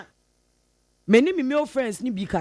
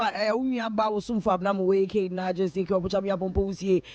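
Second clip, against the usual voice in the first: usual speaking voice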